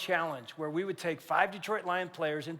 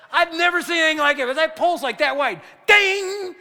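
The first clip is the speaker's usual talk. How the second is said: higher, louder, thrilled retelling; excited shout; 7 semitones higher, 8 dB louder than usual. Real, not acted. Preacher describing the flagpole hit, excited. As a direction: shout it out in amazement. Reliving the amazing kick.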